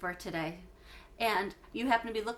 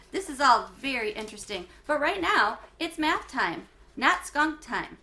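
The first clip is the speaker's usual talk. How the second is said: louder, firm, slowing on not skunk time; firm teacher; 5 semitones higher, 7 dB louder than usual. Acted. Teacher redirecting chatty pupils to math, firm and louder. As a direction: bring the class back to order.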